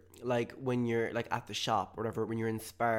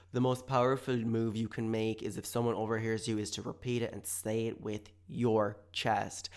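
ordinary talk, nothing marked

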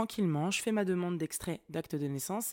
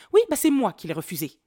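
loud, higher imitation of an irate clerk; employée excédée; 6 semitones higher, 12 dB louder than usual. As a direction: snap it out as the mock-angry clerk. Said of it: "au moment où elle s'est excitée". (employée énervée imitée, voix haute et forte)

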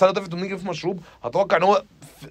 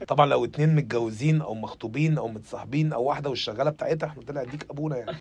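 normal talking voice

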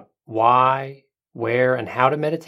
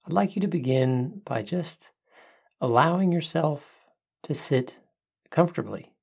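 soft spa whisper; 5 dB quieter than usual. Acted. Leads a breathing meditation.

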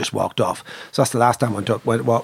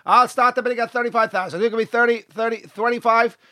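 high, rapid-fire auctioneer chant; 10 semitones higher than usual. Acted. Auctioneer selling a filly to the room, high sing-song patter. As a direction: rattle out the bids on one high note.

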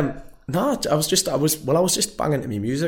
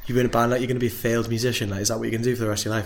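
put-on quick taunting Whitley Bay accent, rushing the end; mock Whitley Bay accent; much faster than usual. Sixth form kids taunting Sam in a rushed Whitley Bay accent. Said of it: "with that Whitley Bay accent".

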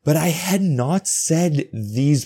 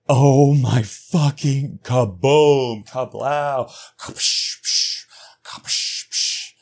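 mind blown noises; 3 semitones higher, much slower than usual. Real, not acted. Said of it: "This was a huge moment".

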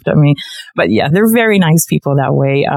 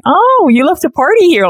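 mock delighted; 12 semitones higher than usual. Mock cheerful quote of herself.

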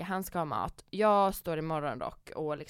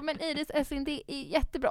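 put-on higher, gentle reassurance to a child; soothing mother; 8 semitones higher than usual. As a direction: reassure the girl sweetly. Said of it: "jag bara". (mamma lugnar Iris med högre röst)

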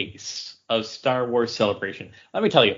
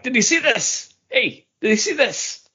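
excited texting friend; 13 semitones higher than usual. Retold or imitated. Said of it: "with a text message being like".

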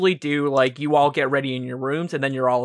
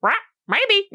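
squawking bird. Acted. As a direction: shriek it like a pet bird.

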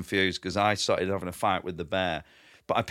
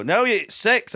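insistent tech; 11 semitones higher, 4 dB louder, much slower than usual. Retold or imitated.

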